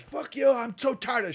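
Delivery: high, loud hostile outburst; hostile Reed. Acted. Reed lashing out at Johns, high and hostile. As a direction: spit it at him. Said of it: "she's just getting hostile".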